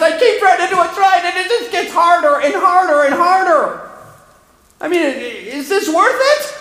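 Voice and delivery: desperate preacher's cry voicing the psalmist; anguished psalmist. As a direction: plead like a worn-out believer at the end of his rope. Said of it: "It really frustrated the psalmist".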